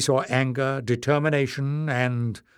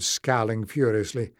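plain reading voice

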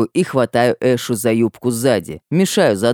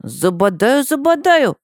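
rising playful squeal, high; 12 semitones higher than usual. A narrator's reading. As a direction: tease like tickling a child. Playful goat game.

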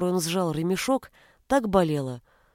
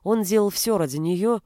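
ordinary delivery, nothing marked

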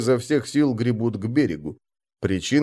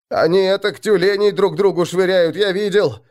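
louder, high voice with chattering teeth; 9 semitones higher, 4 dB louder than usual. A narrator's reading. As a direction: speak through chattering teeth from fear. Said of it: "стуча зубами".

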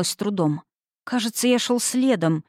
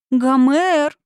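higher, louder desperate call; 7 semitones higher, 6 dB louder than usual. A narrator's reading. She reaches out to the hero, desperate. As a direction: call his name in despair. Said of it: "прошептала она с отчаянием".

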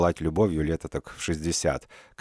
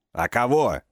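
barked retort; 5 semitones higher, slower than usual. Acted. Injured man barks back at Sasha. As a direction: bark it at her. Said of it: "рявкнул".

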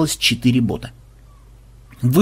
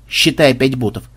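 ordinary delivery

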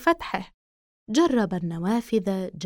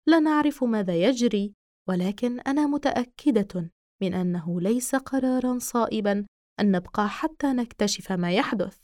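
ordinary delivery

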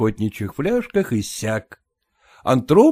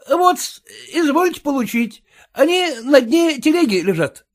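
servant voice; 11 semitones higher than usual. A narrator's reading. Servant reassures the wife.

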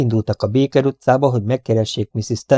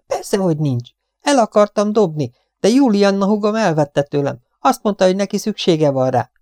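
high-pitched matter-of-fact gossip; chattering cleaning woman, dismissive; 10 semitones higher than usual. A narrator's reading. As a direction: explain it as if obvious. Voices the talkative cleaning woman. (fecsegő takarítónő, magas hangon magyaráz)

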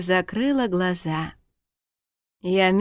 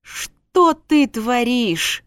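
slow, loud, higher reproach; exasperated; 5 semitones higher, 5 dB louder, much slower than usual. Acted. Husband carrying his drunk wife, exasperated and slow. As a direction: demand it word by drawn-out word.

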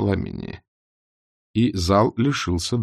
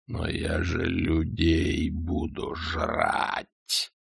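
slow, low, soft dragon; 4 semitones lower, 8 dB quieter, much slower than usual. A narrator's reading. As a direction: let it rumble slowly.

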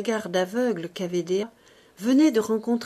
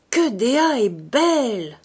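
slow, louder, admiring exclamation; Gwynplaine; 4 semitones higher, 6 dB louder, slower than usual. A narrator's reading. Ursus imitating the young man for Dea, put-on admiring voice. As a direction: put on another man's voice, drawn out. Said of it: "C'était la voix de Gwynplaine".

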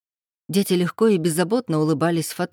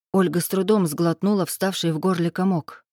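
ordinary delivery

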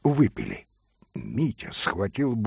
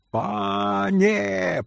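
threatening cry; 7 semitones higher than usual. Acted. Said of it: "прокричали оба пана с угрозою".

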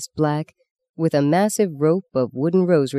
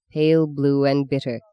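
ordinary delivery

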